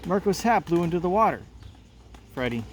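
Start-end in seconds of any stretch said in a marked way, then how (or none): 0.1-1.4 put-on girl shouting to her father over the wind. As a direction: shout to dad across the boat. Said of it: "Mel shouting".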